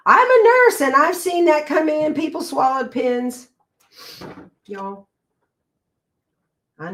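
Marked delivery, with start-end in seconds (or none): 0.1-3.5 high, loud know-it-all nurse; scolding nurse. Retold or imitated. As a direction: play the shocked nurse lecturing. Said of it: "I get".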